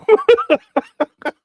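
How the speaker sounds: a high burst of real laughter; real high laugh at the joke; loud laugh. Real, not acted. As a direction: crack up out loud. Host cracks up at the joke.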